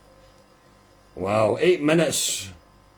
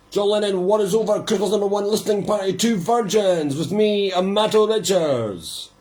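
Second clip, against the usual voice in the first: radio announcer; 7 semitones higher than usual. Acted. Vlogger announces his listening party to viewers, pitched-up DJ voice.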